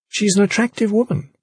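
high pointed jealous tone; a barbed compliment; pointed remark. A narrator's reading.

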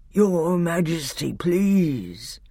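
drawn-out, sleepy-headed plea; thick, sleepy voice. A narrator's reading. The old bear petitions the king, thick and sleepy. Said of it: "a thick, sleepy voice said".

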